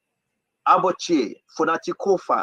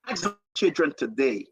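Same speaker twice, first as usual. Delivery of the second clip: ordinary delivery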